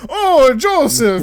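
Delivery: hammed-up cartoon Italian; Italian accent. Caesar calling to Joseph, mock Italian accent. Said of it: "some dude doing an accent".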